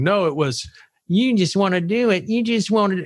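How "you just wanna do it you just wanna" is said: hammed-up Southern accent sending up Republicans; over-the-top Southern twang, higher; exaggerated Southern accent. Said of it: "they all have that exaggerated Southern accent".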